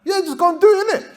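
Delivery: hammed-up lad voice, cracking up; mock street talk with a laugh; street slang. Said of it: "that's how he really speaks".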